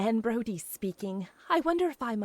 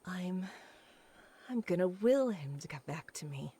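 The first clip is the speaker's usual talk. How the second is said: low, breathless whisper; fervent whisper; 6 semitones lower, 8 dB quieter than usual. Acted. Jilted woman to herself, whispered vow. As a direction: vow it under your breath.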